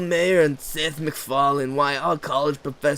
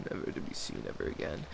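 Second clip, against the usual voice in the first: soft, fast whisper; whispered fast fade-out; dramatic whisper; 9 semitones lower, 14 dB quieter, faster than usual. Acted. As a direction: breathe the doom ending. Dramatic storytelling ending.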